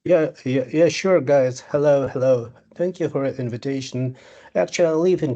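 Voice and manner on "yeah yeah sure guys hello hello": ordinary delivery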